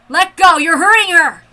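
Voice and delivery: desperate shout. Acted. Pinned woman screaming at her attacker, loud desperate shout. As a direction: yell in alarm.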